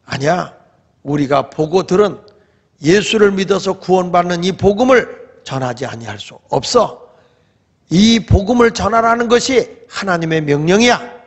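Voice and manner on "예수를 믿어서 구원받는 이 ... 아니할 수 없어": ordinary talk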